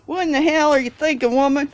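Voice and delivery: loud, high mock-outraged yell; imitating outrage. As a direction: holler it like an appalled dinner guest. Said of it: "they'll look at me like".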